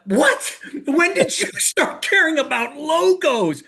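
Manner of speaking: high, amused disbelief with a laugh; incredulous. Retold or imitated. Telling his brother he can't believe he cares about logos, high and laughing. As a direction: squeak out your disbelief, laughing. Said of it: "I'm like".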